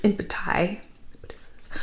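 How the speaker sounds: unsure aside, breathed under the voice; whispered self-correction. Real, not acted. Correcting her own plural under her breath, whispering. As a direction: whisper the fix to yourself. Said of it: "one of the impetuses".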